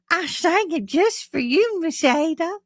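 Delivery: put-on sweetly proud little boy; imitating the boy. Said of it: "He goes".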